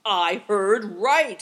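loud, slow, booming declaration; big booming voice; thundering. A narrator's reading. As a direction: thunder it out deliberately. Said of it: "he thundered".

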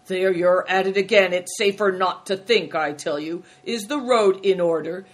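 forceful, impatient rebuke; irritated scolding. A narrator's reading. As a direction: tell off an underling firmly. Greencoat scolds the innkeeper.

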